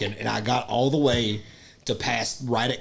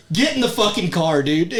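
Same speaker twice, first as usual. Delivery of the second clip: higher, louder order to get in; mock command; 6 semitones higher, 5 dB louder than usual. Friend ordering him into the car, higher and louder. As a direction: bark it like a fed-up friend. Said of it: "And he goes".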